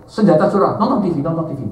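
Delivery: loud, high sermon banter; lively preaching. Acted. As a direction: preach with a grin to the crowd.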